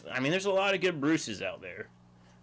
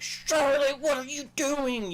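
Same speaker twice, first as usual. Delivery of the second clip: much higher, loud, hugely exaggerated call to a deaf dog; big cartoonish sing-song call, pitched way up; animated calling out; 14 semitones higher, 4 dB louder, slower than usual. Shown as a demonstration. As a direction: overdo it so the deaf dog looks at you. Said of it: "you gotta, like, really get animated".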